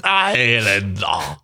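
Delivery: slow stretched Highlander; drawn-out echo. Real, not acted. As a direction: dehn Highlander genüsslich lang. (langgezogenes Highlander)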